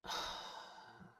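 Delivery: long breathy exhale of annoyance; weary sigh. Acted. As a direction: let out a tired, irritated sigh. After Cambion leaves.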